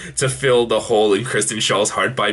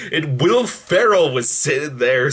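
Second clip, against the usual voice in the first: ordinary talk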